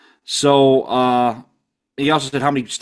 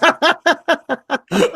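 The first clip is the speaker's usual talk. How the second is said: high laugh; 13 semitones higher than usual. Real, not acted. Laughing at own joke.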